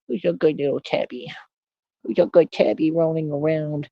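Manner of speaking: sweet talk to a cat. Real, not acted. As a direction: fuss over the tabby. Baby talk to his cat.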